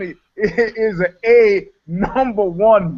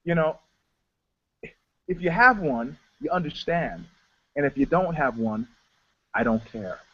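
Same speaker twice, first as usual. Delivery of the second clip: retold cool remark; 3 semitones lower, 7 dB quieter than usual. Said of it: "I said".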